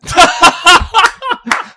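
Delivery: high-pitched burst of laughter. Real, not acted. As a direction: loses it laughing. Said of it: "No, he was not".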